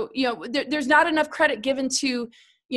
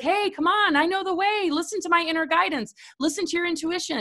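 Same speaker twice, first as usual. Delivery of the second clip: much higher, coaxing inner-guide voice; voice of the soul; 7 semitones higher than usual. Retold or imitated. Soul energy urging people to listen inward, higher and coaxing. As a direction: lift way up and coax like the soul calling you home. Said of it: "our soul energy is like".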